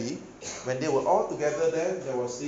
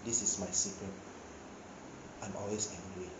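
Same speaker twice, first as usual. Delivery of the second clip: low quiet Hulk line; 4 semitones lower, 10 dB quieter than usual. Retold or imitated. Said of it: "Then he told Captain America".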